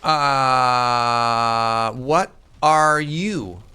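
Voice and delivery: drawn-out, eerie question; slow spooked voice. Pretend. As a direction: stretch it out, unnerved. Confronting monster in game.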